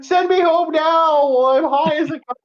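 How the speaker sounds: high, drawn-out whiny plea; hammed-up high-pitched pleading; mock wail. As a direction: cry it out high and slow. Retelling begging to leave hospital.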